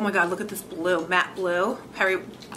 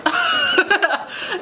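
bright burst of laughter, pitched up; high giddy laugh; 10 semitones higher, 4 dB louder than usual. Real, not acted. Unboxing free makeup for her viewers, a high delighted laugh.

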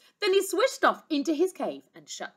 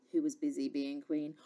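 usual speaking voice

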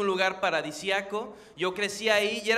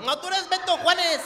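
high-pitched protesting comeback; mock-indignant retort; 7 semitones higher, 3 dB louder than usual. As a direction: burst out to expose his real name. Comic retort exposing the officer.

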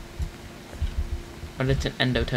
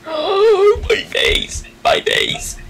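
pained cry; much slower than usual. Acted. Character hurt in game.

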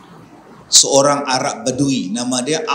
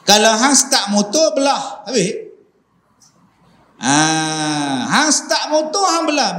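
high mock advice; 6 semitones higher than usual. Acted advice to leave.

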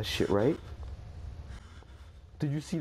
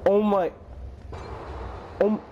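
frightened yelp; 12 semitones higher than usual. Real, not acted. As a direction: jump at the noise. Frightened during horror game.

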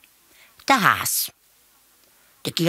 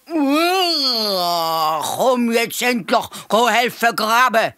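loud roar sliding down into the words; high, long roar, then a loud command; roaring lion; 8 semitones higher, 5 dB louder, much slower than usual. A narrator's reading. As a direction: roar an impatient order like a lion. Lion comes to summon the hare.

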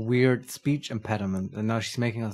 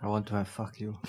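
quiet mocking aside; 8 dB quieter than usual. Player mocks line under breath.

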